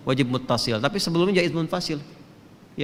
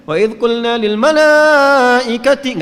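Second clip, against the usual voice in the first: loud, high chant on malaikati, wrongly; too-high recitation; 7 semitones higher, 11 dB louder, much slower than usual. Shown as a demonstration. Teacher showing students the unpleasant high recitation. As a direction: overshoot the pitch deliberately. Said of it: "nggak enak".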